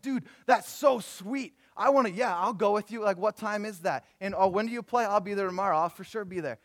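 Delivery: high, loud, gushing fake enthusiasm; false passion. Pastor replaying his fake excitement about a racquetball invite, gushing. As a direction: overdo the eagerness, stretching the first words. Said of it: "I just totally demonstrated false passion".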